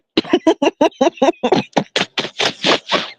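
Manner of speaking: wheezing laugh. Real, not acted. Cracking up.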